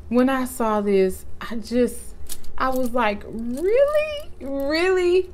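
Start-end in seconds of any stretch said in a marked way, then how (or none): 3.3-5.3 very high-pitched, drawn-up disbelieving question; incredulous. Retold or imitated. Vlogger relives first seeing the gift, high and incredulous. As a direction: squeak it out in astonishment. Acts out amazed reaction to gift.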